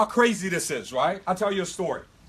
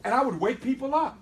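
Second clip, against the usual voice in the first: normal talking voice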